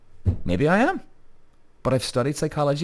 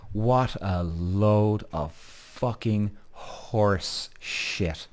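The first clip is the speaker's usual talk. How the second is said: scornful drawl; 2 semitones lower, 3 dB quieter, much slower than usual. Real, not acted. Host scorning people who claim never wrong, slow scornful drag. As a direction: drag the words out slowly, trailing into a hushed shit.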